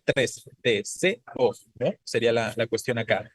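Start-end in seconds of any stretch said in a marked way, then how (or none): 0.6-1.5 "T, C, O" como de-se-o, sílaba por sílaba; separado en sílabas. Shown as a demonstration. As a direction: separa las tres sílabas de "T, C, O": de, se, o. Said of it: "¿Cuántas sílabas tiene?". ("T, C, O" dicho de-se-o, con pausas)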